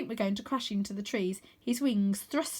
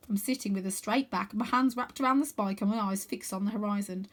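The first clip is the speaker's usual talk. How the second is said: usual speaking voice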